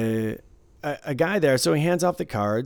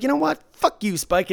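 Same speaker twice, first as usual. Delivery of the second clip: high-pitched mock fury; angry outburst; 11 semitones higher, 5 dB louder than usual. Dealer acting out a player cursing before throwing chips, angry. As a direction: imitate a player snapping into insults.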